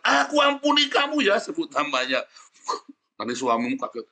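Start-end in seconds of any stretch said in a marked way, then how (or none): 0.0-1.4 high, shouted declaration; loud declaration. Shown as a demonstration. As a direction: proclaim it loudly at high pitch.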